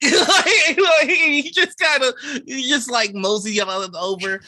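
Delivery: talking through high, loud laughter; real helpless laughter over the words; laughing. Real, not acted. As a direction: crack up while trying to narrate. GM cracks up while narrating.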